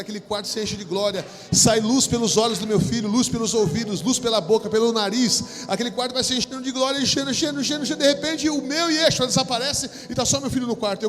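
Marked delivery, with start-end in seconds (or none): 1.5-11.0 louder and higher, building fervor; rising preaching climax. Acted. Preacher describing glory filling the room, building to loud. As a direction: swell the vision into a shout.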